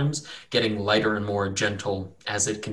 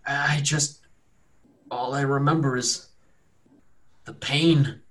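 higher, halting wounded man; dazed wounded captive; 4 semitones higher, slower than usual. Acted. Healed captive recalls his ordeal, halting and dazed. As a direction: recall it in broken pieces, long pauses. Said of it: "a newly found sense of rejuvenation".

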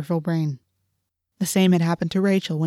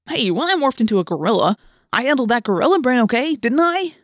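rushed, rising self-defense; indignant demand; 6 semitones higher, 4 dB louder than usual. A narrator's reading. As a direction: demand credit, ending high. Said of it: "Marco demanded".

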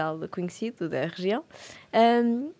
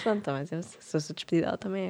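softer, lower, wry muttering to herself; muttered inner thought; 3 semitones lower, 8 dB quieter, faster than usual. Retold or imitated. The nurse thinks to herself while the boss lies on the floor, softer wry mutter. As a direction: think it under your breath.

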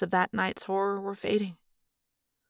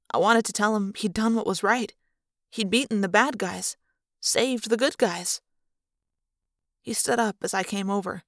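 ordinary delivery, nothing marked

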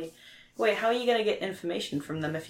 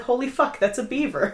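excited; 6 semitones higher, 5 dB louder than usual. Retold or imitated. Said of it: "I was like".

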